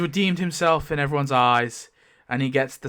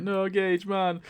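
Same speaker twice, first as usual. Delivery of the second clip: high-pitched mock crying plea; tearful; 7 semitones higher, slower than usual. Rodney pleading with his bitten friend, mock tearful. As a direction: act out a mild, whining grief. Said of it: "he's, like, crying".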